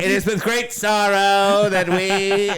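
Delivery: slow, grave wartime newsreader; old radio announcer. Pretend. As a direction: announce tragic news slowly. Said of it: "announce that Pearl Harbor's just been attacked".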